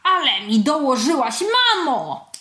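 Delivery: loud, rising to a high wail on "mamo"; indignant exclamation. A narrator's reading. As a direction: protest to mum, worked up. Acted outburst at scolding mother. (z przejętym oburzeniem)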